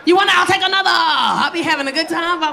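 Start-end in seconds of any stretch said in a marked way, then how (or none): 0.1-0.9 tipsy loud greeting. Retold or imitated. Drunk self greeting at brunch.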